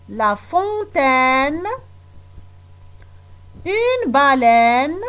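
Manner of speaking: slow, deliberate word-by-word reading; slow reading aloud. Acted. Teacher reading the word list aloud to children, slowly. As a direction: read each word slowly for young pupils.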